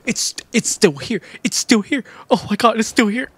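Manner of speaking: high, breathless-with-hope repetition; can't-believe-it excitement, high-pitched; excited relief. Real, not acted. Sudden hope on finding box.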